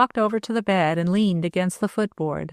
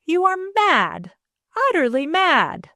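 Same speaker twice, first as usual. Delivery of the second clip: gasped; 10 semitones higher than usual. A narrator's reading. Stepdaughter recoils from her stepfather, aghast gasp. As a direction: gasp it out in horror. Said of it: "she gasped".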